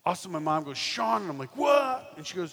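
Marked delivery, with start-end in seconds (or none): 0.8-1.2 high, louder call of his name; mom calling out. Retold or imitated. 1.5-2.1 high, loud startled what; teen yells back down to his mom, high and loud; shouted reply. Retold or imitated.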